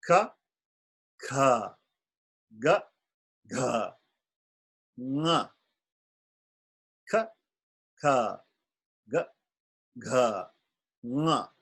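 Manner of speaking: ka kha ga gha nga recited in order; first consonant family. Shown as a demonstration. Teacher reciting the first family of consonants. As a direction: recite the set clearly. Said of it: "let me just say these five".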